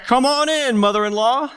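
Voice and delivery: high, loud, bright come-on-in; cheery welcome. Pretend. Preacher mocks welcoming the mother-in-law. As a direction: greet the caller like an old friend. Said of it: "open up the door and be like".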